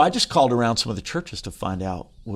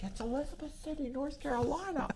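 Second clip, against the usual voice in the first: very high, soft mimicked wife; hushed falsetto report from someone on the phone; wife relaying a call; 15 dB quieter than usual. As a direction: squeak it quietly, far above your own voice.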